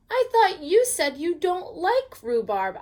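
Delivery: talking through puckered lips; pursed lips. Acted. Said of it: "I said through pursed lips".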